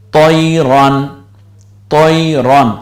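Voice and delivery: তৈরন with lips lightly rounded on ত; heavy rounded ত in তৈরন; rounded tua. Shown as a demonstration. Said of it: "মুখ হালকা গোল করে বলেন".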